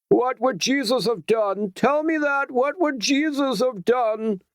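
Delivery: very high voiced bellow, last line slowed and dragged out; bellowing man. A narrator's reading. Man in the crowd bellowing at the pickets, high then slow and heavy. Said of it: "Another man bellowed".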